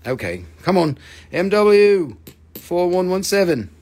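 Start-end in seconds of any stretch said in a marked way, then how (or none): 1.3-3.7 loud, slow, drawn-out announcement; calling out. Real, not acted. Seller announcing a claim to buyers, loud drawn-out call. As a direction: stretch the letters and numbers out loudly.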